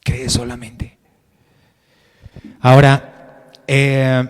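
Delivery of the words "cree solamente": softer, tender repeat; gentle reassurance. Retold or imitated. Jesus softly reassuring Jairo, tender and quiet. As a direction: hush it like comforting a frightened father. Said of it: "Jesús le dice a Jairo".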